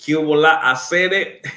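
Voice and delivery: loud, laughing Cuban hello; laughing greeting. Real, not acted. Pastor greets Cuban viewer José with a laughing hello.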